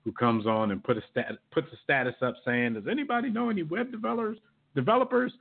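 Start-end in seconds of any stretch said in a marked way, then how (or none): 2.7-5.3 mock plea. Mock Facebook status plea.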